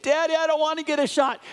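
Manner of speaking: put-on high, fast, whining child begging his dad; whiny scared kid. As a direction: plead like a small boy afraid of needles. Son scared at urgent care.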